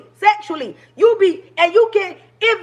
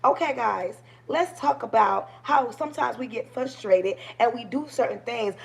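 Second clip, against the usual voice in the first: soft, low, toned-down voice; hushed and measured, as a put-on demonstration; mock polite restraint; 3 semitones lower, 6 dB quieter than usual. Shown as a demonstration. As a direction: imitate the meek, careful talk people want. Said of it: "They want you to be like this".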